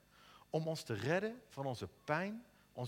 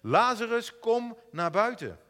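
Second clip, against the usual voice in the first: loud, higher, slow call; Jesus calls Lazarus out of the grave, loud and slow; 7 semitones higher, 10 dB louder, slower than usual. A narrator's reading. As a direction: call him out of the tomb, loud. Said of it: "riep hij met luide stem".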